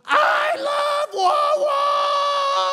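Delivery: very high, very loud, drawn-out joyful yell; ecstatic kid scream. Retold or imitated. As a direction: scream it with pure delight. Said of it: "and he goes".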